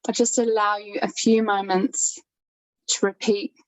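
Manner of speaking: calm, unhurried yoga nidra guidance with long pauses; even, soothing meditation voice, leaving space between phrases; guided meditation. Acted. Leads a yoga nidra practice.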